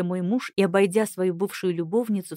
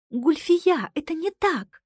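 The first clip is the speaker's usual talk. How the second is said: higher, desperate plea; 8 semitones higher, 4 dB louder, slower than usual. Acted. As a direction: plead desperately, voice rising. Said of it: "отчаянно произнесла Кристиана".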